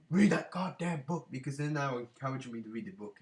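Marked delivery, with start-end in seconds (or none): 0.1-1.3 higher, louder mock demand from a viewer; mock angry commenter. Imagined angry commenter ordering him to read, higher and louder. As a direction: play an annoyed viewer telling him off. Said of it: "please put a comment saying".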